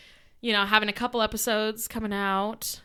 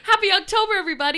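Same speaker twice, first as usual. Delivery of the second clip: excited greeting; 10 semitones higher, 7 dB louder, much faster than usual. Real, not acted. Cheerful show opener.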